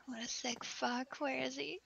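whispering. Real, not acted. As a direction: whisper it under breath. Whispered remark.

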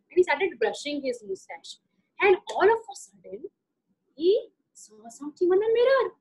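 usual speaking voice

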